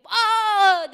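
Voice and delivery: loud high gasping cry; falling cry. Acted. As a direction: cry out as if sinking. Peter sinking, teacher acts.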